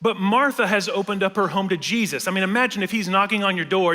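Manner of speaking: ordinary delivery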